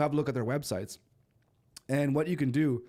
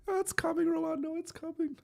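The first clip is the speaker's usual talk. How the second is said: much higher, squeaky imitation of a hopeful fan; high mock voice. Streamer teasing a viewer in a squeaky mock voice. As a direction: pitch it way up, teasing the chat.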